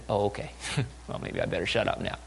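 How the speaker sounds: soft, low, sheepish mock retreat; backing down meekly. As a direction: shrink back quietly with a little chuckle. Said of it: "sit back down in his chair".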